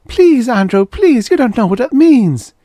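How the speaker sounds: high, loud begging; wife begging her husband; desperate plea. Acted. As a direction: beg him with everything.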